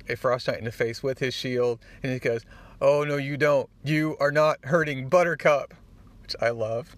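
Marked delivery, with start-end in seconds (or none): 2.8-5.7 louder, higher heroic protest; indignant defiance. Acted. Spider-Man defending his horse from a frost giant, loudly indignant. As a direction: scold an attacker with mock outrage.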